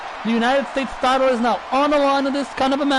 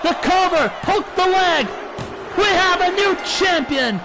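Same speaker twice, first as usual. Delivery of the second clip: wrestling commentator; 4 semitones higher than usual. Real, not acted. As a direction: shout the big moves as they land. Live wrestling play-by-play climax.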